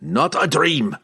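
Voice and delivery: emphatic dwarf denial, dropping at the end; growled. A narrator's reading. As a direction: growl "Not a dream" firmly as a dwarf. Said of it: "Bruner growled".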